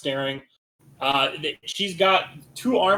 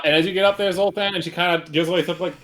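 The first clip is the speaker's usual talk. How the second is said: ordinary talk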